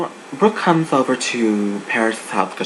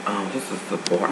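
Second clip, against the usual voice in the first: bored deadpan; 4 semitones lower, 8 dB quieter, much faster than usual. Retold or imitated. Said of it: "I was like".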